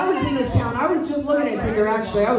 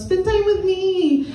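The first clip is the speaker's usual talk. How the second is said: even higher, slow needy whine; clingy girlfriend whine; 9 semitones higher, slower than usual. Acted. A clingy girlfriend whining at her date, high and drawn out. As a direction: beg for time, pouting.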